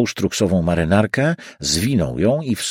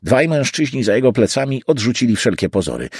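plain reading voice

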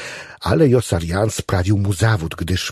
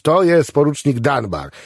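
higher, openly loud answer; full voice; 6 semitones higher, 4 dB louder than usual. A narrator's reading. Patient answers the whispering chaplain, at full voice. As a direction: announce it out loud with no discretion. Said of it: "na cały głos".

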